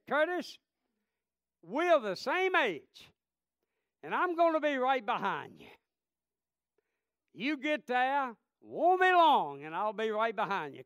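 preacher retelling his promise to dying Curtis, loud and high; impassioned preaching. Acted. Preaching the deathbed story.

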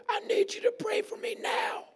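urgent, harsh whispered croak; strained, raspy insistence; sick man's rasp. Acted. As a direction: demand it with almost no voice. Mute man insists again.

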